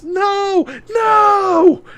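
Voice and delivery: high, loud anguished scream. Acted. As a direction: scream it in despair. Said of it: "Maya turns away screaming".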